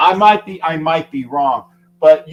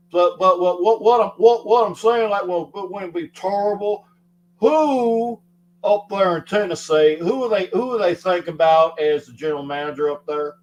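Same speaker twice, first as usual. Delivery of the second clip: put-on Southern accent; 3 semitones higher than usual. Shown as a demonstration. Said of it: "I do a Southern accent. I, I, I, I can do it".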